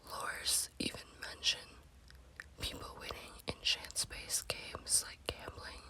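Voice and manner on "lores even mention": plain reading voice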